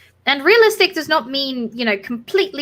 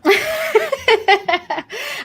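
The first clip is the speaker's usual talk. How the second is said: bright high burst of laughter; 12 semitones higher than usual. Real, not acted. Laughs at Haida joke.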